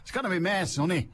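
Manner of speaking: badly faked Scottish accent, sounds Irish; attempted Scottish accent. Host mimics a weather forecaster, botched Scottish accent. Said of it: "that sounds like a Scottish weather forecast".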